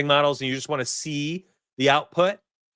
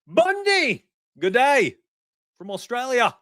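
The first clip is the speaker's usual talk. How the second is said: high-pitched, hammed-up Aussie greeting; badly faked Australian call-out; terrible Australian accent; 12 semitones higher, slower than usual. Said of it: "That was the worst accent".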